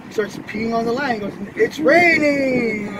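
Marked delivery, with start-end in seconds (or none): 1.6-3.0 slow, louder, laughing "It's raining"; gleeful drawn-out taunt. Acted. Monkey gloating at the lion, slow laughing taunt. Monkey voiced peeing in joke.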